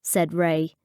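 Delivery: ordinary delivery, nothing marked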